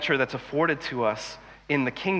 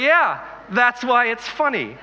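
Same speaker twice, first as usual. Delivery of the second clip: amused exclamation; 8 semitones higher, 3 dB louder, slower than usual. Retold or imitated. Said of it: "I was like".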